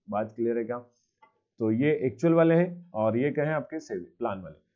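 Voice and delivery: ordinary delivery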